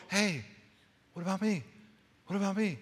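high, soft little plea; light, high voice asking for notice; small gentle call. Acted. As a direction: call softly from the background. God's unheard gentle call, acted.

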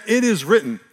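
slow, loud and rising declaration, stretching spectacular and marvelous; declaring out loud. Shown as a demonstration. Preacher modeling a declaration for the congregation, loud and slow. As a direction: proclaim it with full conviction. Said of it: "Say out loud".

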